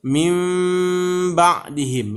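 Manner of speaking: min ba'dihim with n blending into m; as recited. Shown as a demonstration. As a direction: read min ba'dihim as mim ba'dihim, nasal held. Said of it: "kalau membacanya nanti seperti ini".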